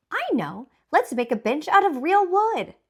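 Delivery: put-on Baby Bear calls out high and loud; excited shout. Baby Bear calling out his idea to his family, excited high shout. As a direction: blurt out the idea, thrilled. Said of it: "Baby Bear shouted".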